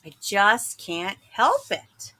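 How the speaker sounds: ordinary delivery, nothing marked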